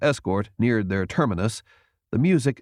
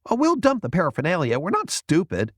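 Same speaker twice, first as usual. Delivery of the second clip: eager youth; 8 semitones higher than usual. A narrator's reading. Jason argues eagerly.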